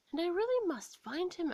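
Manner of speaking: Alice sets her mind on it; eager girl's thought. A narrator's reading. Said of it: "Alice thought".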